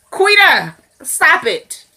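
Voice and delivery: loud, high, falling reprimand; exasperated scolding. Real, not acted. Viewer scolding Quita over her offer, exasperated. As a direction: call her out sharply by name.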